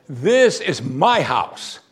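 very slow, weighty claim; each word spaced out with authority; bold declaration. Acted. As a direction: declare ownership slowly and deliberately. Acting Jesus's bold temple claim.

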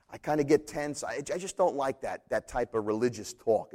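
normal talking voice

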